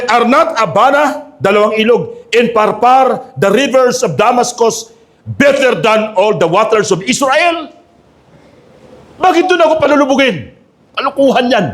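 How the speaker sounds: preacher playing an affronted general; angry Naaman. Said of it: "Galit na galit si Naaman".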